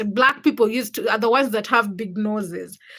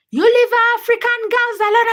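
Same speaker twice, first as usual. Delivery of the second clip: much higher, louder angry shout; mock yell; 12 semitones higher, 6 dB louder than usual. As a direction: bark it at a stranger in the street. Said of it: "telling the man".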